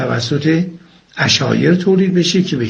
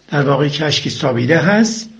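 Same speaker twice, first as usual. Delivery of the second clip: ordinary talk, nothing marked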